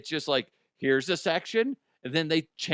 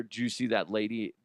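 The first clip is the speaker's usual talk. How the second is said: normal talking voice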